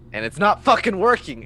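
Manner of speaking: shouting in frustration; frustrated outburst. Real, not acted. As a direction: snap in real exasperation. Frustrated at failed rolls.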